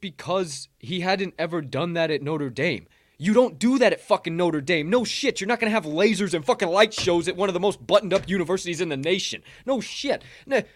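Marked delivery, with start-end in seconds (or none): 3.2-10.6 loud, heated rant; exasperated, punching words out at raised pitch; worked up. Real, not acted. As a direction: get worked up defending him. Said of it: "I'm getting a little worked up".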